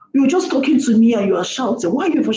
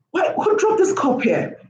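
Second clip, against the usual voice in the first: high-pitched, put-out questioning; mock indignant complaint; acted complaint; 8 semitones higher than usual. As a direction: demand an answer, pitched well up. Acted complaint over a dropped cup.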